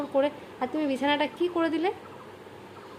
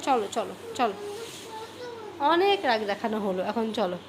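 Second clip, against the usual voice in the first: lower, softer sweet-talk; gentle coaxing; 4 semitones lower, 5 dB quieter than usual. Real, not acted. Mother sweet-talks her child to come eat, soft and low. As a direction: murmur it tenderly to lure him.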